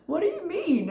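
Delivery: high-pitched baffled question; retold incredulous squeak. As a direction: ask it in high, mock disbelief. Acts out own baffled reaction.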